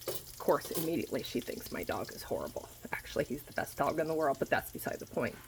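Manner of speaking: grumbling aside about her dog; muttered aside. Real, not acted. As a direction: drop to a near whisper aside. Muttered aside, much softer.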